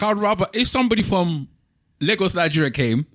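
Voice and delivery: ordinary talk, nothing marked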